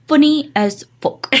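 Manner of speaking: high, slow imitation of a British man; playful British impression; Will's British delivery. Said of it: "I love the way he says that".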